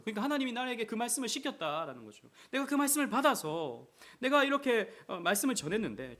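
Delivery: high, loud explaining from the pulpit; preaching at full force. Acted. Pastor explaining the verse to the congregation, high and loud. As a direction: drive the point home to the church.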